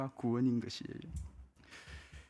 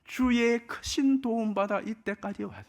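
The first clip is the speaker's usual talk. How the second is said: much higher, stressing 크신; 크신을 높게 강조하며 간증하듯; emphatic personal testimony; 8 semitones higher than usual. Shown as a demonstration. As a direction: lift the voice high on 크신, as your own story. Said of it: "돌이켜보면".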